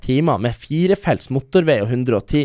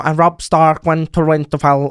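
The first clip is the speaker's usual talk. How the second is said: slow Scottish audiobook reading; mock Scottish accent. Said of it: "en sånn fan som hadde lest inn på skotsk". (etterlignet skotsk aksent, langsomt)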